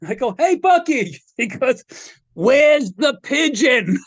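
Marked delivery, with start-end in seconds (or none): 0.4-1.2 high-pitched, eager greeting; retold cheery hail to an old friend; calling back hello. Answering greeting across the house. 2.3-4.0 high, slow, drawn-out question; retold demanding call. Bucky calls out demanding the pet.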